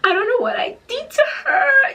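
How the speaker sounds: hammed-up tearful plea, high and loud; mock crying. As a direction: reenact sobbing to him. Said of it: "I'm crying, I'm like".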